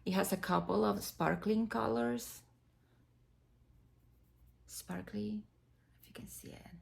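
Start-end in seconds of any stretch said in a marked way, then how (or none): 4.7-6.7 whisper. Real, not acted. Showing sparkly palette up close.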